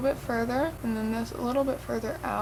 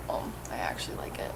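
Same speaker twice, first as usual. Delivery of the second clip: hushed, very low murmur; sheepish change of mind; 11 dB quieter, faster than usual. Retold or imitated. Vlogger mimicking herself five minutes later, quietly won over. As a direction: drop to a small, quiet admission.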